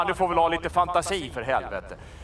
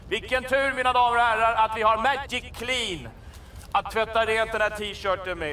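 sales pitch; 4 semitones higher than usual. Acted. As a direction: announce the rescue like a salesman.

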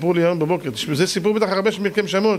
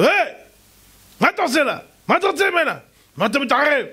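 shouting at attacker; 10 semitones higher, faster than usual. Retold or imitated. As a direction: yell hey at the man to stop him. Boy confronts attacker in street.

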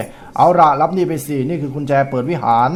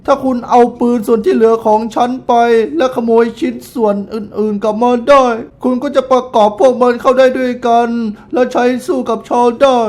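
raised, theatrical middle-aged woman voice; auntie voice; 8 semitones higher, 4 dB louder than usual. Acted. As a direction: play the proud widow auntie, a bit higher. Reading the auntie NPC. (เสียงป้าแบบเล่นละคร สูงขึ้นเล็กน้อย)

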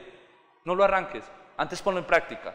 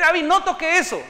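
put-on higher, louder call to stop the child; father scolding his toddler, louder and higher; stern warning; 9 semitones higher, 5 dB louder than usual. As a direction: warn your little girl away from the plug. Father warning toddler near socket.

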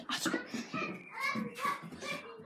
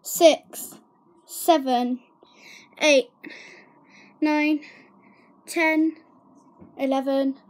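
loud counting; 8 dB louder, much slower than usual. Real, not acted. Seeker counting loudly in game.

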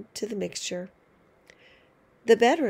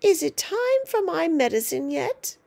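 screechy grandma; 7 semitones higher, 5 dB louder than usual. Acted. Grandma calls from next room.

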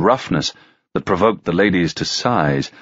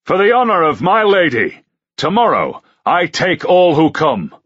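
loud, higher knight's proclamation; ringing challenge; 10 semitones higher, 4 dB louder than usual. A narrator's reading. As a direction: fling it to every corner. Knight challenges the whole hall.

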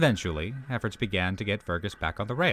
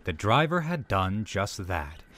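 plain reading voice

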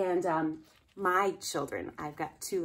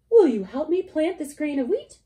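high, louder pleading hen; hen asking; 9 semitones higher, 10 dB louder than usual. Acted. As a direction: ask sweetly as the hen. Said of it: "She asked the pig".

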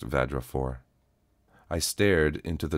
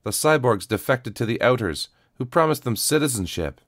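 heated crowd voice; 8 semitones higher, 5 dB louder than usual. A narrator's reading. Angry crowd voice rising.

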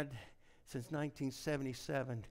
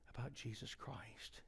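reverent whisper; hushed, slow whispered name; 10 dB quieter, slower than usual. Acted. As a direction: breathe the name softly. Hushed reverent sermon moment.

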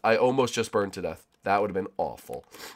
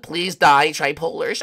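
imitating Bushy; 5 semitones higher, 5 dB louder, slower than usual. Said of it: "That's how Bushy talks".

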